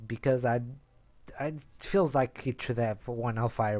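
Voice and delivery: ordinary talk, nothing marked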